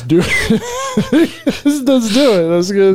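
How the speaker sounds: laughing, squeaky high "let's do it"; giggly voice. Real, not acted. Pitching a fake dinosaur business to his friend, laughing high. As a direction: giggle through the plan. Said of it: "Do we have to talk like that".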